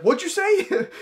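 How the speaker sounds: very high, amused mock challenge; mock indignant drunk. Drunk man overhears dad's insult, high indignant mock challenge. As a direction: imitate an offended drunk through a laugh.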